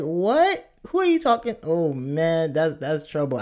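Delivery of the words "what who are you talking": high-pitched, indignant disbelief; mock shocked parent. Parent answers a child's too-casual greeting, mock shocked. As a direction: play a parent taken aback.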